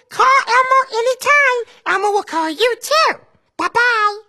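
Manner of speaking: Elmo voice. Acted. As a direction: speak as cheerful Elmo to a child. Elmo's voice throughout.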